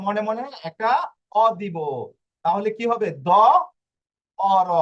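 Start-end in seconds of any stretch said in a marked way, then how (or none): 3.3-4.7 দ, অ, র said one by one; sounded out. Shown as a demonstration. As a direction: build দ অ র from its sounds. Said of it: "বুঝতে পেরেছেন এটার উচ্চারণটা". (ভেঙে ভেঙে উচ্চারণ)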